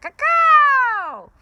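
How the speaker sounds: very high, loud parrot cry; shouted. Acted. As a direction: cry out over the chanting. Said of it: "Papagayo shouted".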